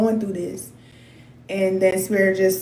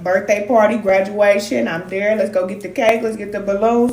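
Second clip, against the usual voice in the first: usual speaking voice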